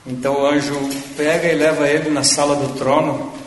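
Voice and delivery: ordinary delivery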